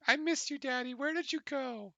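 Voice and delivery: put-on high, squeaky child's cry; tiny kobold squealing with joy; squeaky little kid voice. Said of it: "this little squeaky voice".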